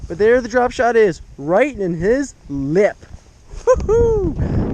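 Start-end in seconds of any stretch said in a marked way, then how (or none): 3.7-4.7 real joyful whoop; triumphant whoop. Real, not acted. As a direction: let out a victory whoop. Celebrating a catch.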